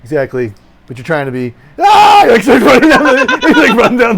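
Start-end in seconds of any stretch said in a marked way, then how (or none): 1.8-2.2 high, loud scream of fright; comic panicked yelp; mock scream. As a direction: shriek in hammed-up terror. Imitates frightened character fleeing.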